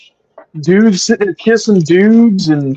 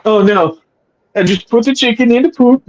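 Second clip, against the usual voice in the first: higher, quicker joking cartoon-chef impression; Swedish Chef; 6 semitones higher than usual. Co-host clowning after being told he sounds off, Swedish Chef impression. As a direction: play along with a silly sing-song chef voice. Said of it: "you sound like the Swedish chef".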